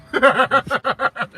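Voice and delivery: loud, high laugh; high cackling giggle. Real, not acted. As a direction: burst out laughing at your dog. Laughs while teasing the dog.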